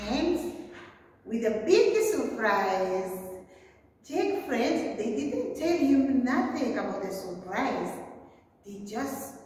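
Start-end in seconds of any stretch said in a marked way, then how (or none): none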